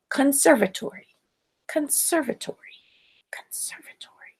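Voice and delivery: fading from spoken to a whisper; repeating to herself. Acted. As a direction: say it again and again, sinking into a whisper. Velma repeats word walking home.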